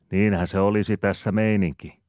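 a lively character answer; Ananiaksen ääni. Acted. (Ananias vastaa Aapelille korkealla, kovalla äänellä)

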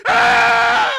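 high, loud wordless shriek; furious agonized scream. A narrator's reading. Burning man after threatening everyone, screaming. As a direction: scream it out at full force.

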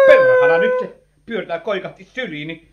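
sudden high, loud alarmed cry; startled outburst. Acted. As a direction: blurt it out as she collapses on you. Woman faints into his arms. (säikähtänyt huudahdus)

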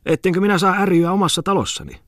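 high-pitched outraged character voice; indignant protest. Acted. Host indignant about bellowing at home.

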